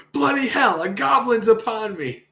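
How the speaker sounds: high, loud alarmed cry; woman washing a pot yelling in fright; startled shout. Acted. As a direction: shout it like a goblin just jumped out.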